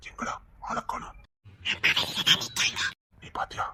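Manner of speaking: hushed unvoiced whisper. Man whispering to his brother so the boy won't hear, hushed. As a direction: whisper so nobody overhears. Hiding from the child, secretive.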